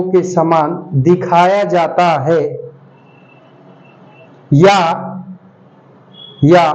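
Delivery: slow, measured dictation in separated phrases; word-by-word classroom dictation. Real, not acted. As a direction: dictate slowly for students writing it down, pausing after each phrase. Teacher dictates notes to students.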